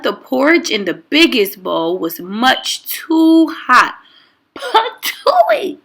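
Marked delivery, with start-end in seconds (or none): 4.5-5.8 very high squealing spit-out "Patooey!"; spitting patooey. A narrator's reading. Goldilocks spitting out hot porridge, high squeal. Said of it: "cried Goldilocks".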